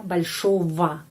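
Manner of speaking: большого said balshova with V not G. Shown as a demonstration. Teacher models большого, G pronounced as V. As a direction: stress the second o, G as V. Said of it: "pengucapannya V".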